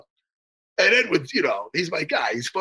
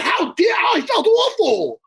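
exasperated outburst; 11 semitones higher, slower than usual. Real, not acted. Reliving guilt about the joke.

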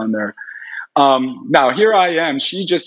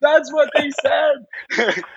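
slow, high, crying-laughing that's what they said; overwhelmed, voice breaking with laughter; tearful laugh; 12 semitones higher, much slower than usual. Real, not acted. Repeating the confirmation to the listener, slow and tearful-laughing.

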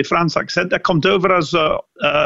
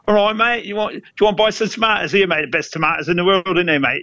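higher, brisk Cockney hawker selling tomatoes; hamming up a Cockney accent; Cockney market trader; 3 semitones higher than usual. Retold or imitated. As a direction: play a cheeky Cockney barrow boy. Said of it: "Terwijl Cockney".

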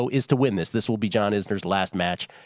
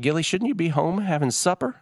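lightly voiced older neighbor, pitch up; a hint of a kindly Southern neighbor scolding; neighbor lady; 7 semitones higher than usual. Host imitating neighbor Velma calling him home, light high voice. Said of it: "she's like".

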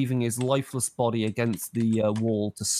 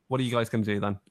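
usual speaking voice

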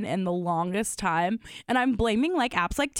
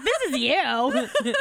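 mocking; 3 semitones higher, much slower than usual. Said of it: "And I was like".

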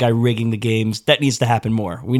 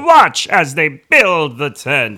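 slow, booming newsreel narrator voice; hammy old-time announcer, drawn out and loud; old radio announcer; 4 semitones higher, 6 dB louder, much slower than usual. Retold or imitated. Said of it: "too Charlton Heston-y".

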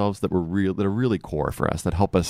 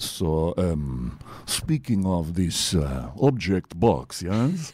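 Dracula voice; slower than usual. Pretend. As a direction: play a slow, creepy Count Dracula.

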